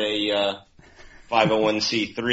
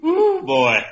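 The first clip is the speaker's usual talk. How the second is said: excited whoop; 5 dB louder than usual. Real, not acted. Reacting to joke.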